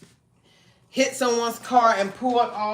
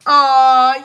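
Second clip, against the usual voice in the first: sudden loud oh; startled cry out; jolted oh; surprised oh; 5 semitones higher, 14 dB louder than usual. Real, not acted. Startled, calling out.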